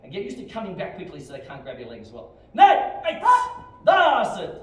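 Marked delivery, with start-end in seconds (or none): 2.5-4.6 high, explosive counting yell; drill command shout. Real, not acted. Instructor calling kicks for students, shouting. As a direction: bellow the count to drive the class.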